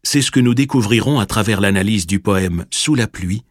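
usual speaking voice

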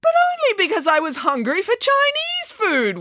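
very high-pitched wail; wailed protest. A narrator's reading. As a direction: wail it out in self-defence. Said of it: "wailed Nanny Piggins".

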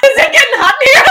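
high, fast, loud laughing tease; teasing laugh. Acted. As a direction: fan yourself and tease through laughter. Teasing after Hemsworth talk.

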